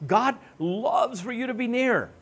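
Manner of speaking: normal talking voice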